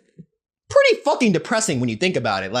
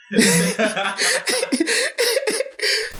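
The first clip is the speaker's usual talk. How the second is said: high burst of laughter. Real, not acted. Bursts out over Halle Berry.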